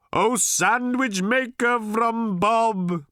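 high, slow chanting proclamation; grand pronouncement. A narrator's reading. Old Thrashbarg holds arms aloft to Arthur, pronouncing grandly. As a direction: intone it like a prophet addressing the heavens. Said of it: "he pronounced".

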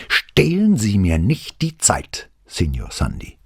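slow, loud, high reprimand; angry shout. A narrator's reading. Investigator rebukes a stalling witness, angrily shouting. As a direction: shout it word by word, dropping on the name. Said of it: "schrie Brunetti".

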